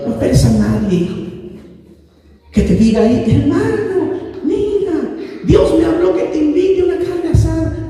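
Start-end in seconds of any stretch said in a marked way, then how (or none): 3.3-7.9 higher, laughing imitation of a cheery brother; mock-friendly hermano voice through laughter; laughing mock invite. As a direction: tease the idea with a chuckle. Mocking a brother's easy invitation.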